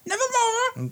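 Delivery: high shrieking crow cry; crow squawk. Acted. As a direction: screech it like a raven. GM voices crow flying by.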